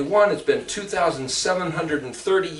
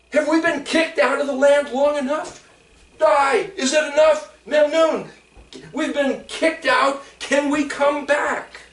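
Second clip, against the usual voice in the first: pleading; 6 semitones higher than usual. Acted. Voicing exiles' plea.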